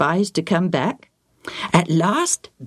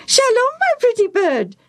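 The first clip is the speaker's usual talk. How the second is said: high squawking parrot echo; 4 dB louder than usual. Acted. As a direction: mimic it back as a parrot, much higher and louder. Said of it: "the parrot repeated".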